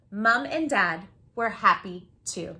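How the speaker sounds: ordinary delivery, nothing marked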